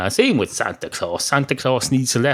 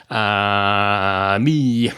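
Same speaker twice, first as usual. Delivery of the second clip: long droning uh before answering; awkward, stalling reply; hesitant answer. Acted. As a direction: stall on a drawn-out hum, then own up. Dog hesitates when asked who's asking.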